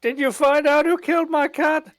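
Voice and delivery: high client voice. Pretend. Client in mock noir skit.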